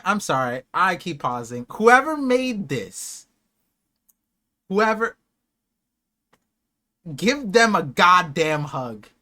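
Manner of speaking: ordinary talk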